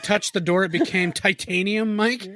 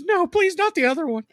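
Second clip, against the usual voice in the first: pleading; 12 semitones higher than usual. Retold or imitated. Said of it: "and she goes".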